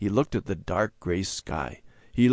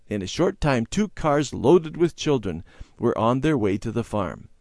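ordinary delivery, nothing marked

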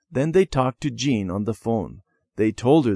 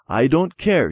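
defiant; 6 semitones higher, 5 dB louder than usual. A narrator's reading. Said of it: "she said".

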